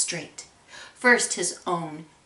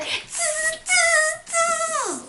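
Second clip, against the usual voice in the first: long, high-pitched hissed "tss" noises; monkey chatter; much slower than usual. Acted. Monkeys mock the shouting peddler, high monkey chatter. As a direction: squeal three drawn-out monkey sounds.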